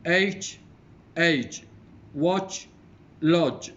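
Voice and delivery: loud, separated words, rising then falling in pairs; teacher's model pronunciation, each word on its own; word-list reading. Shown as a demonstration. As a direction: read out minimal pairs clearly for a class. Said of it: "going to read these, the words".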